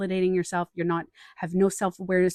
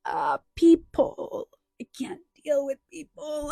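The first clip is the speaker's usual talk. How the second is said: higher, strained whiny cry about crowds; overwhelmed mock wail; 8 semitones higher, slower than usual. About the Christmas mall crowd, mock overwhelmed wail. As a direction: wail like the crowds are too much. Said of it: "I was like".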